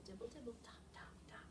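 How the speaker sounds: fading to a whisper; whispered rain. Shown as a demonstration. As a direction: let the rain patter away softly. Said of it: "hear some expression".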